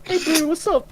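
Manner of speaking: very high, loud bro greeting; mock Kiwi bro. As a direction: ham up a cheery Kiwi bro. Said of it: "they're like".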